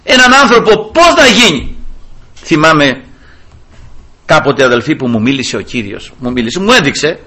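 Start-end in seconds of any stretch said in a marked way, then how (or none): 0.0-1.8 high, loud fervent sermon voice; impassioned preaching. Acted. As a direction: preach it with rising fervour. Preaching at full force. (κήρυγμα με πάθος)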